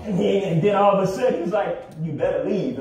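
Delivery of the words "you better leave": imitating raspy elder. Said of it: "his very raspy voice".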